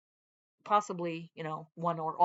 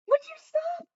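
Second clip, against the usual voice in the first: much higher, fed-up scold at the dog; exasperated scold; 3 dB louder than usual. Real, not acted. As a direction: snap at the pet to stop.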